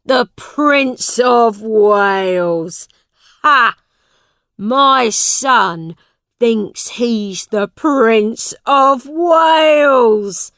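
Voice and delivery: loud, slow, jeering father; mocking shout. A narrator's reading. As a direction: bellow it in scornful disbelief. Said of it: "shouted John Canty".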